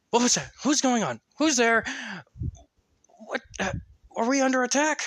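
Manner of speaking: bursting out ready for battle; alarmed archer. Acted.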